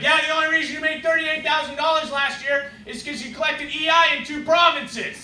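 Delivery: high, loud retelling of an outburst; accusing outburst. Retold or imitated. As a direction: act out an indignant outburst, high-pitched.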